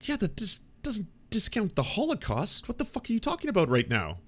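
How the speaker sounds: high, exasperated disbelief; incredulous outrage. Real, not acted. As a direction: cannot believe what he heard. Outraged at the Holocaust comparison.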